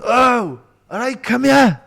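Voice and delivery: calls out high and loud, all friendly; drunk mate calling over. Retold or imitated. Drunk singer calling the teller over, loud and high. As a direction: hail him over like an old drunk pal. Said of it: "and he went".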